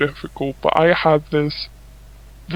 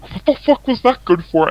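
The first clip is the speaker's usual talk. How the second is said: higher-pitched retold outrage; put-on offended disbelief; mock indignation; 8 semitones higher, 3 dB louder than usual. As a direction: imitate an affronted man, pitched up. Retelling punched man's outrage.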